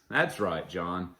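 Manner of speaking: ordinary talk, nothing marked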